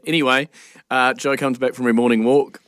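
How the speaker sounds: ordinary delivery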